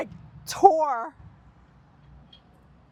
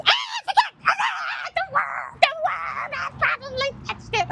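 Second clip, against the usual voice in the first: panicked shriek. Real, not acted. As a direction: shriek in real disgust and fright. Remembers the worm, panics.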